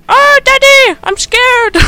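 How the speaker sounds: put-on very high, loud squeaky kid voice; mock scared child. Kid on the phone to Daddy, mock scared squeal. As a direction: squeal it like a hammed-up frightened child. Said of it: "his kid's like".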